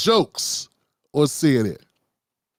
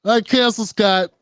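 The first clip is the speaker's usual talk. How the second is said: ordinary delivery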